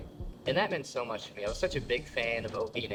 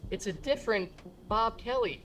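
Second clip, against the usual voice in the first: lightly raised, patient protest; exasperated correction; 10 semitones higher than usual. Retold or imitated. Son correcting his mom, exasperated. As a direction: set mom straight.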